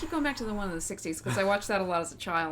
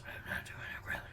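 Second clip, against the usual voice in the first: whispered laugh; 13 dB quieter than usual. Real, not acted. Laughing at being caught.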